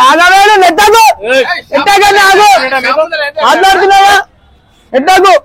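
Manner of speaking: high, urgent objection to shoving; agitated protest. Acted. Protests at being pushed.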